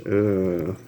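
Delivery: plain reading voice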